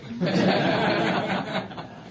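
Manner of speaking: a real high-pitched laugh. Real, not acted. Speaker amused that the guests loved it, laughing high.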